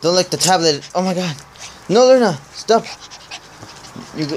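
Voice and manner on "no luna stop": squealing protest. Real, not acted. Dog licking, protesting.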